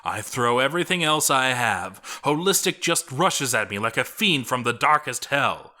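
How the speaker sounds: plain reading voice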